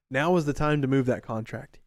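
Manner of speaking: ordinary talk, nothing marked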